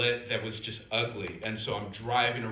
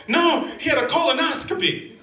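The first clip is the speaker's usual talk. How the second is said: high, loud comic outburst; acted yell, pitched way up; exasperated shout; 8 dB louder than usual. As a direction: shout the correction in mock exasperation. Acted exasperated answer to guesses.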